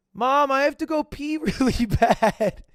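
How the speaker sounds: put-on high, slow whiny kid voice; whiny little girl. Little girl begging her mom to pee, high and whiny. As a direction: beg like a desperate little girl. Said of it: "She does this".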